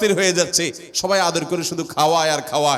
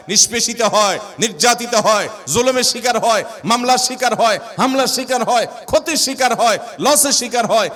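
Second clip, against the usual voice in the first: louder, higher fervent preaching; preaching climax; 5 semitones higher, 4 dB louder than usual. Acted. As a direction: build the list with rising fervor. (উঁচু, জোরালো ওয়াজের সুর)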